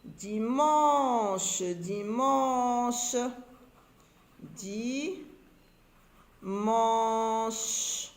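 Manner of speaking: dimanche stretched slowly with a long nasal man; drawn-out "an". Shown as a demonstration. Teacher letting readers hear the sound in dimanche, drawn out. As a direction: hold the middle of "dimanche". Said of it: "J'entends en".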